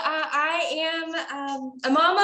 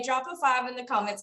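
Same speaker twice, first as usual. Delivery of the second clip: ordinary talk, nothing marked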